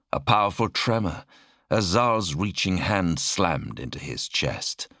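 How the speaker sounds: ordinary delivery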